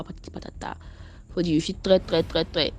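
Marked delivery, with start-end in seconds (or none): 0.0-0.8 dismissive whisper. Retold or imitated. Retelling the man's offers.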